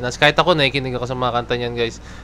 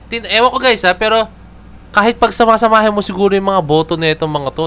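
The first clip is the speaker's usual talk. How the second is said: excited; 5 semitones higher, 3 dB louder than usual. Real, not acted. Still amazed at votes.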